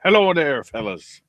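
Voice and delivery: put-on Irish accent greeting. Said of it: "Don't know why I did that in an Irish accent".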